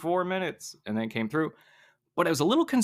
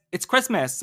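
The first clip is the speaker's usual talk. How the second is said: bitchy; 5 semitones higher, 3 dB louder than usual. Retold or imitated. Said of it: "it came as".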